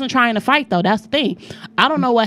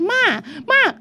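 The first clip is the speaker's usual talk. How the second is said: put-on child calling out; 11 semitones higher than usual. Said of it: "My son was like".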